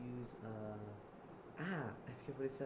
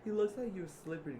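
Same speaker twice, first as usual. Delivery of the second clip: high, loud acted jeer; hammed-up gloating at someone's slip; mocking laugh; 10 semitones higher, 7 dB louder than usual. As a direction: open with a fake haha and taunt. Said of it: "and Zip laughed".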